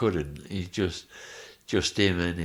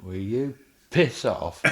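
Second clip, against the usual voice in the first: laughing; 5 semitones higher, 4 dB louder than usual. Retold or imitated. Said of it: "And I went".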